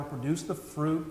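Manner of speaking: ordinary talk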